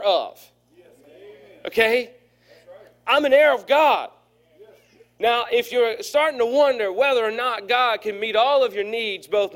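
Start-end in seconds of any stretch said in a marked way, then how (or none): 3.1-4.1 louder, higher preacher's cry on heir; sermon peak, lifting the voice on heir; emphatic proclamation. Real, not acted. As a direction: ring it out, I'm an heir of God. Preacher stressing his point.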